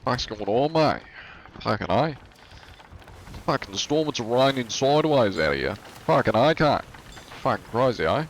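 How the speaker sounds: put-on broad, sweary Aussie accent; Australian accent. Streamer imitating Australians on the weather, Australian accent. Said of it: "The Australians be like".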